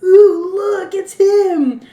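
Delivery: high, loud, drawn-out ooh; gushing mom. Retold or imitated. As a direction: swoon over him slowly.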